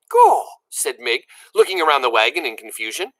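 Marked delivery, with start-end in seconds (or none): 0.1-0.5 high, loud gasp of wonder; high loud Gaw of surprise; amazed exclamation. A narrator's reading. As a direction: blurt it out astonished. Mig exclaims.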